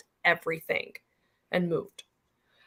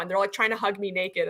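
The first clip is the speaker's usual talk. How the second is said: usual speaking voice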